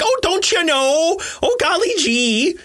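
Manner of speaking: high, slow hammed-up Michigan accent; exaggerated Midwestern Michigan twang; mock Michigan accent. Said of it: "sounds like somebody doing an impression".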